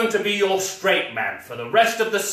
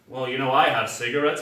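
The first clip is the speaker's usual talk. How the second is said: normal talking voice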